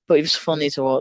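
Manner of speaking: normal talking voice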